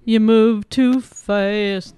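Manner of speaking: very slow, loud, odd voice; slow drawn-out. Pretend. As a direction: stretch every word out slowly. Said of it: "Slow down".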